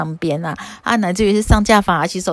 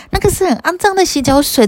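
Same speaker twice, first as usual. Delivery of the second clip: put-on much higher, indignant girlish voice; disgusted girl; 10 semitones higher, 3 dB louder than usual. Matangi girl refuses the foot-washing water, indignant higher voice. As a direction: recoil at the dirty water in a girlish voice.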